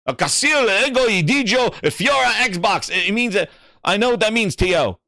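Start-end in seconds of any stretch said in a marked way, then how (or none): 0.1-2.9 higher-pitched, halting attempt at Spanish; amateur Spanish. Real, not acted. Streamer sounding out a viewer's Spanish message, amateur Spanish. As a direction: read out Spanish you don't know. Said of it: "I don't know Spanish".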